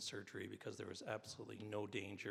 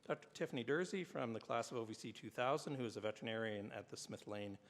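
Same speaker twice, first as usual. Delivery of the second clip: formal ceremony announcer; award announcer. Acted. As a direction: read it like an awards presenter. Reads award citations at ceremony.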